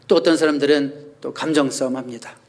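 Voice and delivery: ordinary delivery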